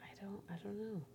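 quick breathy whisper. Real, not acted. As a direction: hush it out fast. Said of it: "whispered aside".

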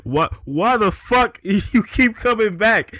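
high, loud, exasperated outburst; furious Dormammu rant. Acted. Dormammu raging at Doctor Strange for returning, furious. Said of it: "He was so fucking mad".

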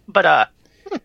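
hiccuping mid-sentence; talking through hiccups. Real, not acted. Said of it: "sorry, I have the hiccups".

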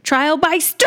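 high, hammed-up proclamation; mock battle cry. Imitating a Skeksis challenging the Chamberlain, mock battle cry. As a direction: call it out, stretching the last word. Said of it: "So he's like".

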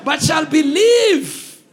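loud, high, slow scripture call; big preacher voice cueing the congregation; rousing read-along. Real, not acted. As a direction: draw out each phrase loudly for the crowd to finish. Leading congregation in reading verse.

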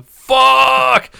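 long, high, loud curse; full-volume mock howl; yelling. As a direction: imitate a friend's drawn-out yell of frustration. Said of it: "I hear him yelling".

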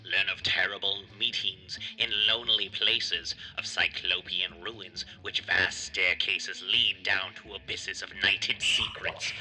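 slow, deliberate announcer read; show intro read. Acted. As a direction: read it like a measured horror-show trailer. Reads the show's intro.

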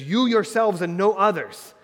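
loud, high stress on YOU YOURSELVES; forceful, insistent quote, every word driven home; emphatic. Acted. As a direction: hammer YOU YOURSELVES and NO OTHERS. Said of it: "the emphatic nature of this".

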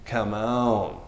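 ordinary delivery

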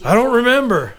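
imitating father-in-law. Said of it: "And he's like".

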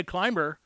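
"climber" with the B almost unheard; silent B. Shown as a demonstration. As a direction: glide from M straight to "-er" in "climber". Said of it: "you almost don't hear the B".

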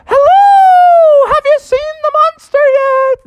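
high, loud holler from far below. Acted. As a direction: call up the mountain in a big high shout. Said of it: "they hollered down".

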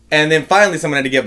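usual speaking voice

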